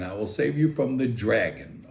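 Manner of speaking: ordinary talk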